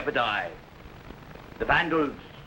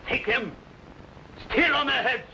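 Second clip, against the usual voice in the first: rising menacing boast; 9 semitones higher than usual. Acted. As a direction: swell into a conqueror's triumphant cry. Attila boasts of conquering the world.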